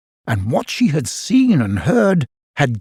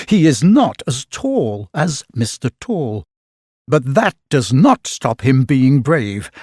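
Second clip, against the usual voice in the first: plain reading voice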